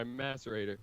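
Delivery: "macerator" with a short a, as in mass; British-style macerator. Shown as a demonstration. Joker mourning stolen gear says it for Preston, "macerator" as MASS-erator. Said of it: "I said it like that just for you".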